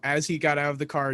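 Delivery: ordinary delivery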